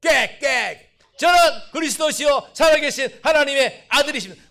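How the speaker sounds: full-voiced shouted declaration, pitched high; loud quack-shout. Shown as a demonstration. Pastor demonstrating shouted confession to the congregation, loud shout. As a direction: belt it out like a wild cry. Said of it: "이렇게 꽥꽥이야".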